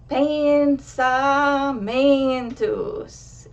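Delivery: Pensamentos with every syllable stressed, loud and long; weirdly over-accented Pensamentos, booming and very slow; too many accents. Shown as a demonstration. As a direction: overdo it, hammering each syllable of Pensamentos. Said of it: "don't put more than one accented syllable".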